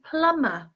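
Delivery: plumber, B left out; plumber with silent B. Shown as a demonstration. Teacher models plumber for learners, silent B. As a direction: model plumber without any B sound. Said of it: "We don't pronounce the B".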